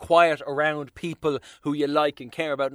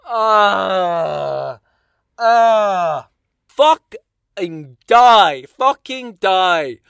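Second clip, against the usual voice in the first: loud, slow strangled rage; loud, drawn-out despairing snarl; anguished fury; 6 dB louder, much slower than usual. Real, not acted. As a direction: groan then spit it out. Despairing climax.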